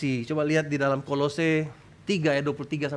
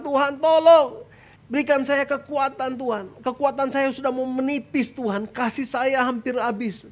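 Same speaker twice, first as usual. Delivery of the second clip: desperate acted prayer; 8 semitones higher than usual. Believer running out of strength begs God, high pleading. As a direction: beg God as strength runs out.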